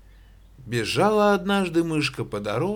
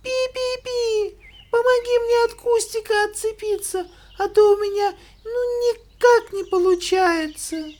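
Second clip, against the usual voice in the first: very high squeaky mouse voice; 3 dB louder than usual. Acted. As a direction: squeak out a plea for help. Mouse pleads to ant. (писклявый мышиный голосок)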